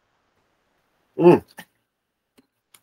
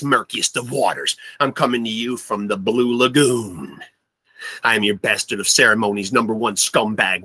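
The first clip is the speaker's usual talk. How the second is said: normal talking voice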